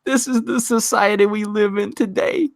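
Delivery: breaks into a whispered high giggle; can't hold it together reading the tweet; laughing through the read. Real, not acted. Reading a funny tweet to viewers, dissolving into laughter. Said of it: "reading the tweet".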